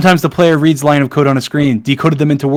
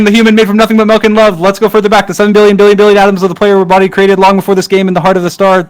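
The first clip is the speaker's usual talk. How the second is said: fast, raised and louder reading; hurried loud reading; 6 semitones higher, 6 dB louder than usual. Real, not acted. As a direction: push through the text in a rush. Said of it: "I'm reading this so fast".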